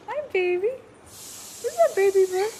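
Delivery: sweet high pet talk. Real, not acted. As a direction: coo up high to the little bird. Cooing to new baby cockatoo.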